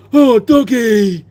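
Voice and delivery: slow, high, loud cooing at a dog; wife spotting a cute dog, cooing; delighted "oh doggy". Retold or imitated. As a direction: coo at the little dog with delight. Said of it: "my wife went".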